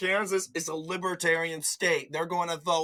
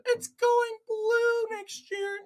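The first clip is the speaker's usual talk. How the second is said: much higher, slow, squeaky mock voice; mocking quote; slower than usual. Vlogger mocking claims Kansas goes blue, slow high squeaky parody. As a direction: drag it out like a naive pundit.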